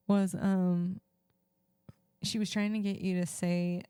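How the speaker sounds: usual speaking voice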